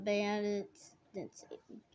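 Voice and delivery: usual speaking voice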